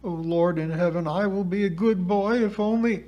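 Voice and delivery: ordinary delivery, nothing marked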